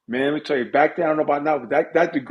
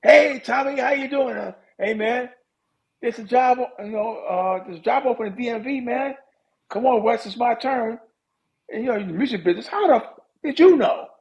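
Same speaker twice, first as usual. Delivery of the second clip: high, excited begging to the boss; eager pleading; 9 semitones higher than usual. Retold or imitated. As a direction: pitch yourself hard and keyed-up for the job. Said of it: "I call".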